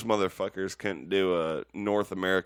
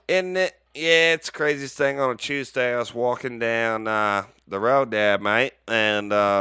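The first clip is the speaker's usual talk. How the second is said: loud, raised Aussie storyteller; Australian accent; 3 semitones higher, 7 dB louder than usual. Acted. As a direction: spin a yarn in broad mock Australian. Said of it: "Pretty good Australian".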